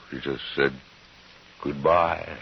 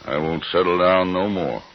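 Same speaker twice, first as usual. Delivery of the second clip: louder, higher insistent refusal; raised voice; 6 semitones higher, 7 dB louder than usual. Acted. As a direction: push back hard, voice rising. Jim refusing to settle down.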